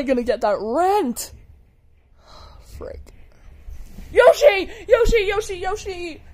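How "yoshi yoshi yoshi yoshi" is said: fan screaming name. Acted. Fan shouting Yoshi's name.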